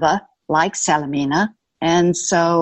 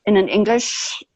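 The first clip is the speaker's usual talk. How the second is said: ordinary talk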